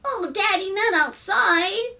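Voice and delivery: put-on high, drawn-out child's plea; pleading little boy. A narrator's reading. Son begs to keep frog inside, pleading. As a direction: whine it to Daddy.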